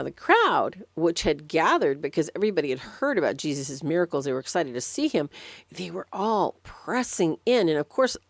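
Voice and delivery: usual speaking voice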